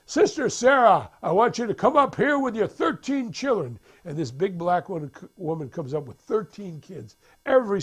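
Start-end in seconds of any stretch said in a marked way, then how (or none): 0.1-3.8 high, loud preacher calling someone forward; prédicateur. Retold or imitated. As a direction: summon her from the pulpit. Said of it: "the preacher says".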